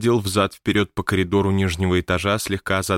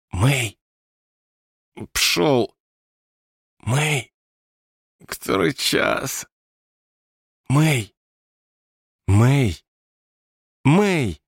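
higher, insistent calls of a name; short, high calls to rouse someone; calling out; 7 semitones higher, much slower than usual. A narrator's reading. Ghost calling May in her room at night, insistent calling out.

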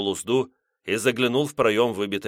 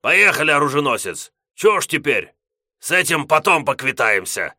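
calling out; 6 semitones higher, 6 dB louder than usual. Acted. Gos calling loudly.

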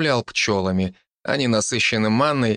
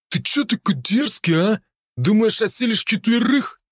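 russian street thug; 12 semitones higher than usual. Acted. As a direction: sneer like a cocky street punk. Thug challenges narrator.

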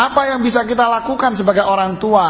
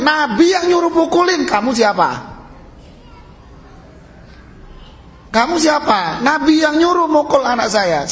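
higher-pitched challenge, rising to make the point; indignant retort; 5 semitones higher than usual. Acted. Preacher rebuking a child association that forbids hitting, indignant. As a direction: push back at an imagined objector in a raised pitch.

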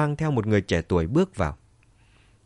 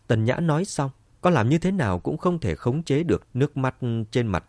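ordinary delivery

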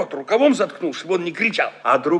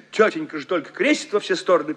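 plain reading voice

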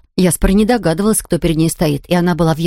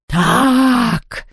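higher, stretched villain exclamation; roared word; 5 semitones higher than usual. A narrator's reading. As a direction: draw out one word as a menacing roar. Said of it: "ревела кровавая баронесса".

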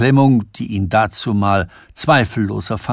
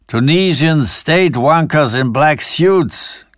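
higher, slow, cackling mockery; 5 semitones higher, 3 dB louder than usual. Sanford mocking the secret police to Preising, cackling. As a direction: relish the rude joke. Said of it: "gackerte Sanford".

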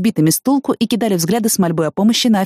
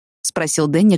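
ordinary delivery, nothing marked

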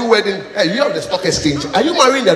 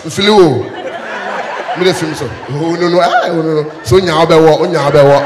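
loud, lower scornful no no; scornful refusal; 4 semitones lower, 5 dB louder than usual. Acted. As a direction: brush him off loudly with a laugh. Woman rejecting poor suitor.

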